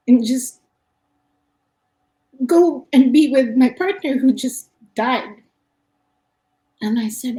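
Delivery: holding back real tears while retelling; choked up recounting his words; tearful. Real, not acted. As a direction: voice lifting high and tight, on the edge of tears. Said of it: "you're gonna make me cry".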